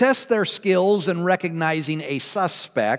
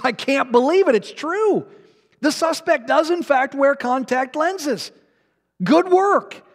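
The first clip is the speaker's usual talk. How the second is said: much higher, excited praise; amazed and delighted; 7 semitones higher than usual. Acted. Policeman praising the third trainee, high and thrilled. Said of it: "with a beaming smile on his face".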